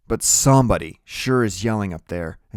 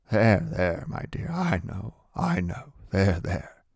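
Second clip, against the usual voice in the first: hushed, consoling murmur; soothing; slower than usual. A narrator's reading. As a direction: comfort someone who is crying. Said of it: "trying to make her feel better".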